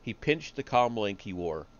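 ordinary delivery, nothing marked

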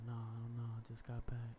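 normal talking voice

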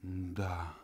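plain reading voice

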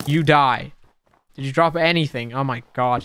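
ordinary talk

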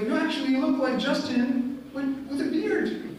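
high, laughing mock of the guest; amused delegate. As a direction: tease him about the beard with a laugh. Delegates say he looks like Justin.

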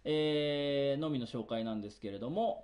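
normal talking voice

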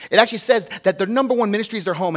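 fast run through "their number one ministry is their home"; quick citing. Real, not acted. Pastor quickly quoting scripture, fast. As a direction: rattle it off as a reference. Same line rushed as citation.

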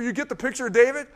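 ordinary talk, nothing marked

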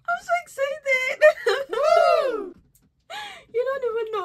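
high, loud cheer with a laugh; excited shout. Real, not acted. Unboxing gifts, cheering loudly with a laugh.

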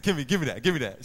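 loud firm give me that; a father insisting his daughter hand it over; stern dad demand. Retold or imitated. Dad demanding the phone from his daughter, loud and firm.

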